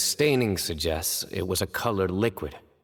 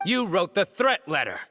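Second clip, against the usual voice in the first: higher, pointed accusing call; forceful accusation; 11 semitones higher than usual. Acted. Investigator to culprit over threat letter, forceful accusation. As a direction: confront the suspect head-on.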